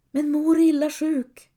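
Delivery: put-on a frightened girl forcing the words out; blurted in fear. Helga begs the priest for her mother, anxious. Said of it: "får Helga ur sig".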